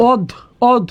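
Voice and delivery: "অধ" as a clipped syllable closed on a held d; doubled consonant. Shown as a demonstration. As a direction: say "অধ" alone as "odd", stopping on the d. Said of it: "তার মানে উচ্চারণ দ্বিত্ব হবে".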